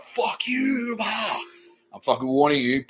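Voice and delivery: sudden high yell, then a growled warning; mock angry outburst. Streamer reenacting his rage at the cafe, shouting. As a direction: act out blowing up at the cafe staff. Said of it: "when I got angry, it's like".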